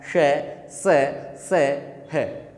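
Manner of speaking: "श, स, से, है" one at a time with pauses; fricatives demonstrated. Shown as a demonstration. Teacher demonstrating friction sounds, "श, स, से, है" isolated and spaced. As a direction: push warm air through each of "श, स, से, है" separately. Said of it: "देखो कैसे उच्चारण कर रहा हूँ मैं".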